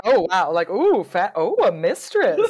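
loud, laughing gasps of fake wonder; hammed-up giggling amazement; mock wide-eyed awe. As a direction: gush over it like a starstruck courtier. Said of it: "I thought".

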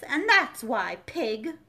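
sudden loud snap on pig; sudden violence. A narrator's reading. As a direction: bark the last word at the baby. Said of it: "with such sudden violence".

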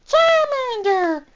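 drawn-out high Charmander call; Charmander cry. Pretend. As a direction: call out the name like the creature itself. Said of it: "You sound like a Charmander".

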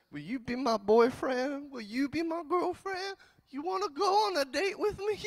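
put-on high, pleading teenage asker; awkward date request. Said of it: "And it's almost awkward".

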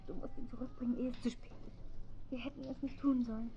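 hushed and distant, begging in a dream; a faint far-off voice pleading; eerie whispered plea. Acted. Wife in a nightmare pleads with her husband, hushed and distant.

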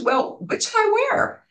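lightly voiced Halle asking the women for advice; imitating Halle. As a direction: pitch way up, an innocent newcomer asking. Halle asks the women innocently.

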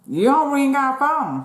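high, slow scolding; indignant high complaint. Retold or imitated. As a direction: call her out, drawn out. Retold quarrel with mother.

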